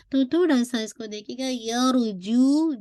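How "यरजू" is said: यरजू slow, जू without air; correct recitation. Shown as a demonstration. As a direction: clean जू, no breath. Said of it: "जू में air नहीं आनी चाहिए". (सही यरजू, जू में हवा नहीं)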